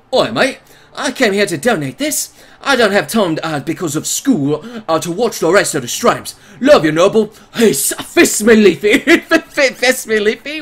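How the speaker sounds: hammed-up mixed British accents; put-on British accent. Streamer reading a donor's message, mock British accent. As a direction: try on every British accent, badly. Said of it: "I wasn't sure what British accent you wanted there".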